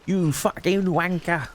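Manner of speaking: slow, exaggerated English insult; mock English accent. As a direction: make fun of an English accent. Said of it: "when making fun of the way we speak".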